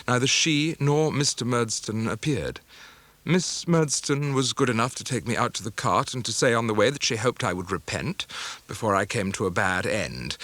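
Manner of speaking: usual speaking voice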